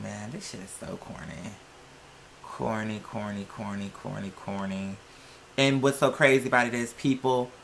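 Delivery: ordinary delivery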